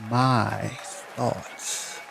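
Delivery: breathy dramatic whisper of title. Shown as a demonstration. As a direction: whisper it like a secret. Announcing poem title twice.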